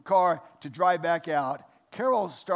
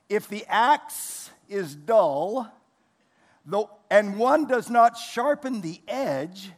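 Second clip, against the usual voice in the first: scripture reading; 4 semitones higher, 4 dB louder, much slower than usual. Acted. Reads scripture verse.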